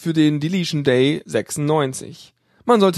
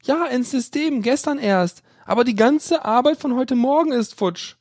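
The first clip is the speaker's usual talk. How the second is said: put-on higher timid young woman's voice; worried female caller; 9 semitones higher than usual. A narrator's reading. Female student asking the admin on the phone, timid. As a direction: play the unsure caller asking.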